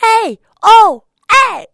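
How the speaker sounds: very high, loud furious yelps; angry frog shouts. Acted. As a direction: yell in fury from the pocket. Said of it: "commence vraiment à s'énerver".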